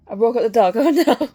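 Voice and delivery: loud, laughing through the words; real laughter, sudden and loud. Real, not acted. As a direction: laugh at your own outburst. Laughs after waking the dog.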